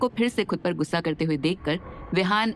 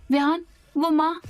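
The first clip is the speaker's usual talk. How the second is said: flustered; 9 semitones higher than usual. A narrator's reading. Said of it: "हड़बड़ाहट के साथ परेशान होते हुए कहती है".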